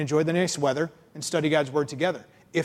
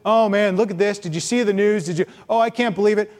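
higher, louder mock lament; mock whining; 3 semitones higher, 4 dB louder than usual. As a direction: whine like a gossiping churchgoer. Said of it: "complain in our little holy huddles".

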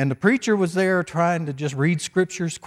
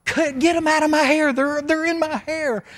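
frantic screaming; 12 semitones higher, 3 dB louder than usual. Retold or imitated. Pastor acting out the hippie begging for a haircut, screaming. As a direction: plead at the top of the range, acting it out. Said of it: "kept screaming louder and louder".

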